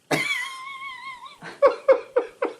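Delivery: very high squealing laugh; high-pitched outburst noise. Retold or imitated. Storyteller acting out her outburst, high squealing noise.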